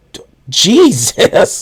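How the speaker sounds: high, drawn-out appalled cry; mock shocked outburst. Podcaster reacting to a creepy film line, mock shocked. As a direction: stretch it out high, in exaggerated disbelief.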